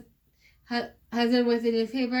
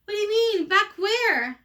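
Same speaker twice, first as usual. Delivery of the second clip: shocked; 9 semitones higher, 4 dB louder than usual. Acted. Mother shocked by daughter's wish.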